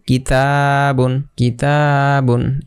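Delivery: কিতাবুন said slowly, the a after ত held one beat; one alif stretch. Shown as a demonstration. Teacher modelling কিতাবুন for the class, slowly. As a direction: draw out কিতাবুন syllable by syllable. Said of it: "এখানে এক আলিফ টেনে পড়তে হবে".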